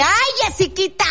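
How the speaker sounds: high, sing-song mother's call; mom's little tune on Jessiquita; imitating mom. Shown as a demonstration. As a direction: sing it out like mom calling her daughter. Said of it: "dímela como la decía ella".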